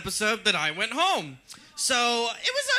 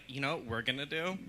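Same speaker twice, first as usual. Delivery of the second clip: sly producer aside; 6 semitones lower, 10 dB quieter than usual. Retold or imitated. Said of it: "producers are gonna be like".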